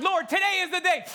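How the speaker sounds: high, loud psyched-up vow; hyped declaration. Retold or imitated. Pastor mocking his own attempt to walk on water, hyped. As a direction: pump yourself up before stepping out.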